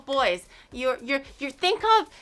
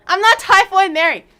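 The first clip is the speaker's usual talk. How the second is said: indignant shout; 8 semitones higher, 11 dB louder than usual. Acted. Tavern wench scolding customers.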